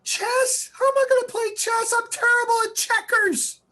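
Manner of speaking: very high, loud mock wail; whining child. Boy protesting to his mother about chess, mock wailing. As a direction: wail like a distraught little boy, hammed up.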